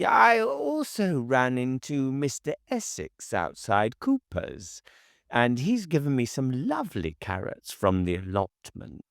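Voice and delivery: put-on high, fussy gossiping old woman; chattering busybody neighbour. A narrator's reading. Neighbour chattering to Oshiva about his shopping, high and fussy.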